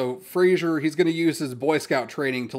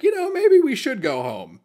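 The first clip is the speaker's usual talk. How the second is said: high and louder, quick backpedal; sudden change of mind; 13 semitones higher, 6 dB louder than usual. Retold or imitated. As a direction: blurt it out, suddenly eager to leave. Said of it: "Fraser's like".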